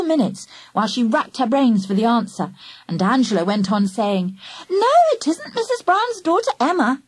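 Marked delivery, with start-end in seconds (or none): 4.7-7.0 put-on child voice. A narrator's reading. Angela teasing in child voice.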